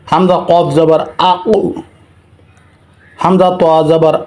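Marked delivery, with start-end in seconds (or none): 1.3-1.6 "আ উ" with the final qaf bounced; qalqala bounce. Shown as a demonstration. Teacher demonstrates qalqala to viewers, bouncing the final qaf of "আ উ". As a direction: push off the stopped q of "আ উ" into a short echo. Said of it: "ধাক্কা দিয়ে পড়িতে হয়".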